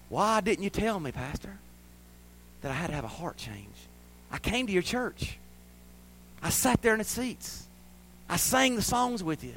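softly voiced pleading reproach; imagined reproach. Young ladies asking pastor why, imagined reproach. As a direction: plead softly, one point after another. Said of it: "these young ladies sitting here and haunt me".

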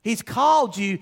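rising preaching. Acted. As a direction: preach it with growing force toward move on. Preacher building to climax.

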